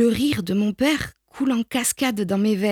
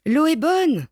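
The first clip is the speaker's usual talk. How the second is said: calling out; 7 semitones higher than usual. A narrator's reading. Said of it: "crie-t-il".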